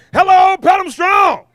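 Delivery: loud, high rally call to the crowd; crowd hype shout. Acted. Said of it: "we can do better than that".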